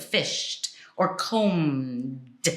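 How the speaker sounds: FISHED as "fisht", COMBED drawn out to a voiced D; slow one-syllable "fished" and "combed"; no vowel before ending. Shown as a demonstration. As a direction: run "fished" straight into T, hold the M of "combed". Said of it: "You just go right into it".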